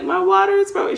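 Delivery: high-pitched mock whimper; acted tearful whine; retold crying. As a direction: imitate yourself wailing through tears. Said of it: "like, crying and I'm like".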